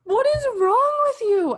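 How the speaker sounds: high, loud mock outrage; yelling at the character on screen; exasperated yell. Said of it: "I'm like".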